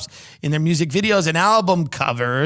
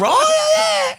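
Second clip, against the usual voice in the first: high laugh. Real, not acted. Laughing about the accent joke.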